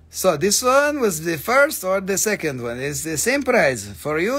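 sing-song mock Russian auctioneer; put-on Russian accent. Seller telling Svetlana to choose one crystal, mock Russian accent. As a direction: lay down the rules in a Russian accent.

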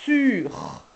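"sur" with the s held long; stretched word. Shown as a demonstration. Teacher sounding out "sur" for parents. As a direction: draw the word out slowly. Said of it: "Par exemple".

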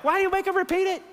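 high-pitched incredulous protest; exasperated, voice shooting way up; comic disbelief in a high voice; mock exasperation. Comic exasperation at the retold mistake.

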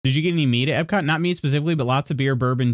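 ordinary talk, nothing marked

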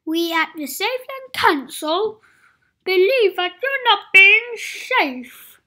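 loud, stern official pronouncement; sighing official. Acted. Mister Safe telling Adventurous his cave closes, sighing. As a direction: announce it like a stuffy council. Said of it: "sighed Mister Safe".

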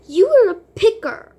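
loud high taunt; taunting shout. Acted. Daughter yelling at the brother who told on her. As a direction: jeer at him.

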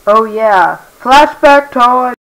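loud, slow excited announcement. Acted. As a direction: announce it like a big reveal.

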